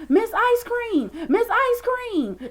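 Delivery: eager calling out. Retold or imitated. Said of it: "I was like".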